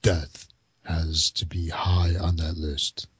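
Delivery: low, slow trailer announcer; deep dramatic voiceover for a film; movie trailer voice. Acted. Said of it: "in my movie voice".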